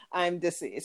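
normal talking voice

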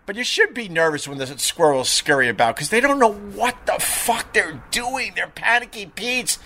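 normal talking voice

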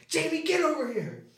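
mom calling. Retold or imitated. Mom dragging Jamie outside.

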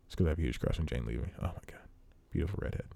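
low, quick, under-the-breath confession; hushed aside. Real, not acted. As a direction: drop the voice for a private admission. Embarrassed aside confessing a crush.

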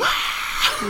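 high breathy mock yell "Ah"; kid's yell. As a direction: let out a short high kid's shriek. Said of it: "And he just goes".

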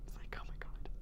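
breathless whispered "Oh my God"; hushed excitement. Retold or imitated. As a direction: whisper it in awe. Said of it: "freaking out".

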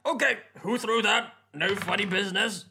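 yelling out. Acted. Player's character challenging a hidden stone-thrower, yelling out. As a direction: call out loudly to an unseen attacker. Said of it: "I'm gonna be yelling out".

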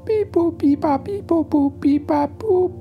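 high loud beep boop alarm noise. Acted. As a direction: chirp it like a robot alarm.

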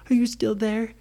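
put-on high, anxious little girl. A narrator's reading. Peggy checking on Brady as she climbs, high and nervous. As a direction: ask like a nervous small child.

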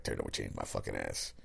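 gruff mock coach. Host imitating coach Paul Chryst dismissing the turnover chain, low mock grumble. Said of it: "I was like".

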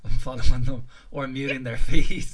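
words broken by laughter; laughing while talking. Real, not acted. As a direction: laugh through the line. Laughing about muting people.

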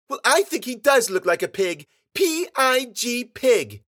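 put-on high, loud child spelling it out slowly; insistent little girl. A narrator's reading. Girl arguing he looks like a pig, insistent. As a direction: spell it at them like a stubborn girl.

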